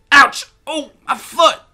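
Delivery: high, loud cry of pain; pained yelp. A narrator's reading. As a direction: yelp over a hurt foot. Boy hurts his foot mid-tussle.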